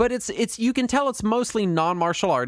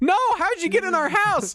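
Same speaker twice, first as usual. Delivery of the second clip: put-on high, frightened little kid; scared kid voice; 13 semitones higher than usual. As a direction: squeal it like a startled child. Kids answer the cereal mascot.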